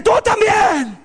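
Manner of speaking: high mock-furious shout; furious mom. Furious mom turns on the second son, high yell. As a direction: imitate an enraged mother turning on you. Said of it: "viene furiosa, enojada".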